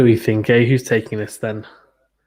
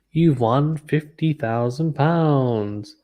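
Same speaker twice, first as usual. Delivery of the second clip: drawn-out game-show prize reveal; playful host stretching out the big win; mock quiz host; 3 semitones higher, slower than usual. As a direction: announce it slowly like a TV quiz host.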